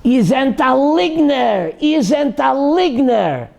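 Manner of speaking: imitated high, slow yell of accusation; retold yelling. Rabbi accusing a woman before his students, yelling. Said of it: "and he starts yelling".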